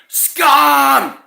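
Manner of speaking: loud, high yell of abuse; retold yell. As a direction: yell it out loud across the street. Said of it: "just, just yelled".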